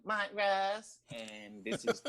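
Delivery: teasing impression breaking into laughter; mock host voice. Guest parodies the host introducing him, laughing mock impression. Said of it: "in your voice depending on who you say it to".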